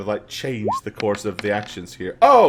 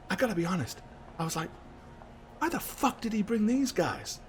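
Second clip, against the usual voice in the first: soft, slightly higher character aside; quiet confiding; 8 semitones higher, 10 dB quieter, faster than usual. Acted. Callie speaking privately to Crow about the guests, quietly. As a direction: keep it hushed and private.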